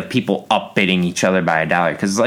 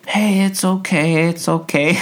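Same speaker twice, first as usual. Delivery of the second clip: higher, slow mock comfort; mock consoling; 8 semitones higher, slower than usual. Family consoling the loser, slow mock comfort. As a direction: parody soothing the contestant. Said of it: "they have to".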